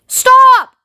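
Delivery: high, loud drawn-out yell; panicked yell. A narrator's reading. Grandma Josephine begging the elevator to stop, yelled. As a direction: yell it over roaring wind. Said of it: "yelled Grandma Josephine".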